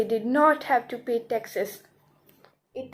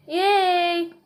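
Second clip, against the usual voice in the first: raised, drawn-out cheer; party cheer; 5 semitones higher, 3 dB louder than usual. Acted. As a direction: let out a long celebrating cheer.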